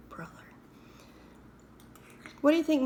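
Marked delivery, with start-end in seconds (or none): 0.0-0.3 exasperated whispered sigh. Real, not acted. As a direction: breathe it out, fed up. Sighing at the remark.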